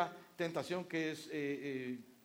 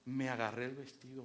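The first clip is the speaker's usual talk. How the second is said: normal talking voice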